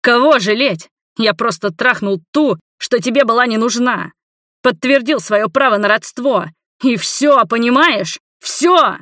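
loud, high, defensive outburst; heated, exasperated shouting; yelling. A narrator's reading. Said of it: "начал орать я".

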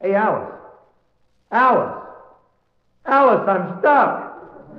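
high-pitched call for his wife. Acted. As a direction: call her, stretched out and stuck. Calling for wife from inside statue.

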